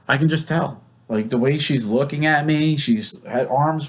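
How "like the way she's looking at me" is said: ordinary talk, nothing marked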